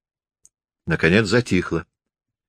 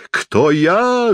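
high, drawn-out ghostly wail; dreadful howl; 10 semitones higher than usual. A narrator's reading. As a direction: howl it like a stage phantom. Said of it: "завыл незнакомец ужасным воем". (жуткий вой привидения)

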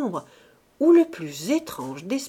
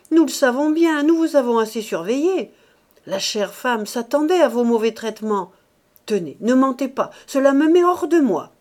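loud, raised, heated accusation; exasperated shouting; 5 semitones higher, 8 dB louder than usual. A narrator's reading. Rose calling Mouret a liar, exasperated shouting. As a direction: keep berating him at high volume. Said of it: "cria Rose exaspérée".

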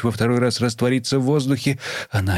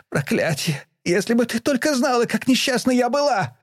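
very high, raw cry of pain; anguished scream; 13 semitones higher than usual. A narrator's reading. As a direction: scream out the hurt. Daughter screams her misery.

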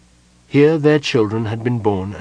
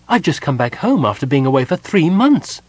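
higher, indignant disbelief; astonished; 5 semitones higher, 4 dB louder than usual. A narrator's reading. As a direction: protest in disbelief.

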